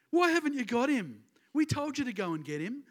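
whiny Pharisees. Retold or imitated. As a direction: nag like a spoilt child. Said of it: "A bit whiny".

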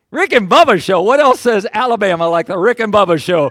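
big incredulous retold reaction; excited disbelief. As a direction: exclaim it with hammed-up delight. Acts out delighted reaction to radio.

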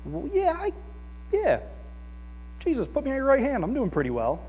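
high, fast smug self-praise; smug ego voice. Retold or imitated. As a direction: voice your ego preening. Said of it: "my ego is like".

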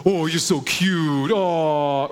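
put-on cooing at a baby in a light sing-song; baby talk. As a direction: coo to a nine-month-old. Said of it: "No, you do this".

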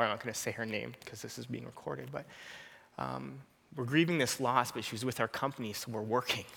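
ordinary delivery

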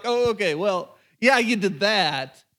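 lightly acted hedging with a drawn-out hesitation; mildly timid, doubtful agreement; nervous, cautious. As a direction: concede reluctantly, then stall. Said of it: "He was still nervous".

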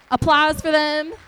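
rally the room to clap; calling for applause. Real, not acted. Calls for applause for kids.

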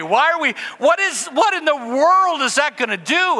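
high-pitched, incredulous comic rant; exasperated disbelief. Retold or imitated. Acting out disbelief at bomb drills.

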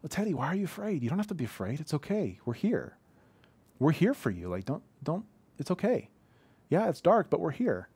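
put-on high, gentle soothing of a scared toddler; parental comfort. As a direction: parent calming a scared son in the dark. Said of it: "our response to him is always parental".

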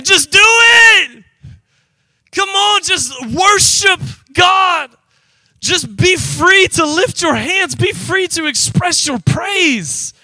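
high, slow, shouted pleading; heart's urging shout. Retold or imitated. Preacher voicing his heart, urging himself to worship, shouting. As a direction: shout it out like your heart begging you. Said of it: "my heart is saying".

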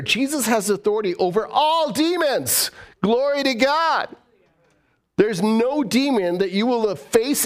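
high, rising preacher's cry; fiery preaching. Acted. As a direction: preach it out with fervor. Preaching at full force.

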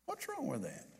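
quiet echo of the retort; repeated under breath. Retold or imitated. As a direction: let the repeat drop away, nearly to nothing. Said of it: "And she said".

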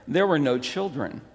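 ordinary talk, nothing marked

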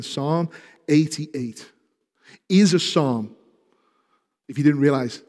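ordinary talk, nothing marked

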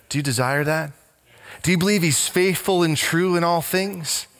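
usual speaking voice

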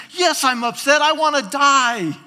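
loud, high tantrum outburst; hammed-up, indignant wail of complaint; upset. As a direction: shout back at God as a sulking Jonah. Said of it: "and Jonah is upset".

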